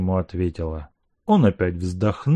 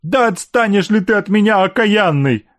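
crying; 9 semitones higher, 4 dB louder than usual. A narrator's reading. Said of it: "говорила она, плача".

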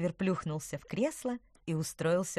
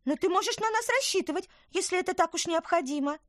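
high, bright little woodland creature; chirpy tiny creature, earnest; little apple creature; 10 semitones higher, 6 dB louder than usual. Acted. Apple-folk character Tykver speaks.

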